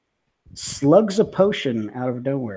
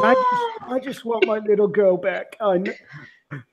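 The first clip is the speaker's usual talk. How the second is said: put-on high, breaking plea for his little girl; a father begging through tears; pleading father; 6 semitones higher than usual. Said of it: "he's just inconsolable".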